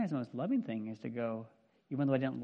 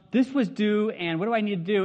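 higher, louder anxious student plea; pleading student; 6 semitones higher, 9 dB louder than usual. Retold or imitated. Teenage [name] begging his counselor for help, anxious. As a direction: whine like a panicked teen. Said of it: "I would show up in her office and go".

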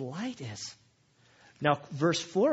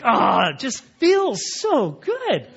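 touched delight; 6 semitones higher, 10 dB louder, slower than usual. Retold or imitated. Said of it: "And I'm like".

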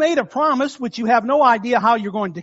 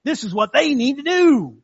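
armchair critic. Retold or imitated. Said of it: "simply say".

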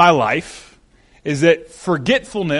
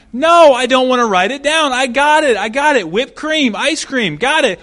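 put-on louder, higher stubborn old man; insistent husband; 5 semitones higher, 5 dB louder than usual. Old man refusing to write the order down, stubborn raised voice. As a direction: wave her off, louder and higher.

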